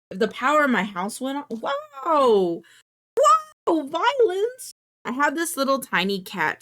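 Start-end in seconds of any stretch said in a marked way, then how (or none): 1.5-4.7 much higher, louder mock outrage; mock shocked. Real, not acted. Streamer's cat swats the desk figure, mock shocked outcry. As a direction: cry out at the cat's attack.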